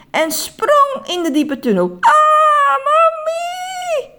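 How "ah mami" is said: very high, loud falling scream. Acted. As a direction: scream for mummy in pure panic. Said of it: "Hij kon het niet helpen om luid te gillen".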